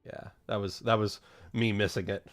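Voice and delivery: normal talking voice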